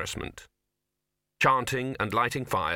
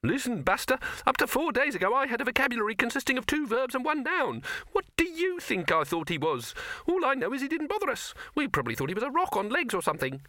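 high, snippy rabbit voice, speeding up at the end; a cheeky high rabbit talking down to him; irritated rabbit. Acted. Rabbit telling Victor off.

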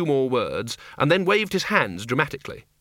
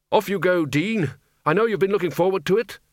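higher-pitched, brisk send-off; hearty Archchancellor; 7 semitones higher than usual. A narrator's reading. Archchancellor sends Dean off.

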